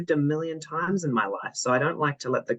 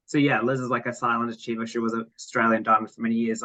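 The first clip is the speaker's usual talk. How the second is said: ordinary delivery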